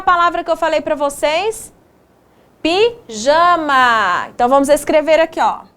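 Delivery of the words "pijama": slow, split after the first syllable. Shown as a demonstration. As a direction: break the word up for young learners. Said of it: "separamos essas palavras em sílabas".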